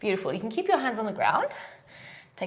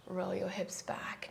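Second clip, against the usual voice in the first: slow whispered cue; a yoga teacher whispering the cue; 2 semitones lower, 12 dB quieter than usual. Real, not acted. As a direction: hush it out gently and slowly.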